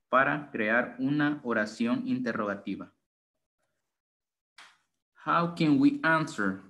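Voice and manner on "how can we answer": ordinary delivery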